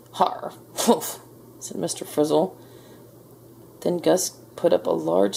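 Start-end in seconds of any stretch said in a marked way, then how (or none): 0.1-1.2 grudging harrumph. A narrator's reading. Frizzle giving in to Gus, harrumphing. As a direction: grumble it without real voice.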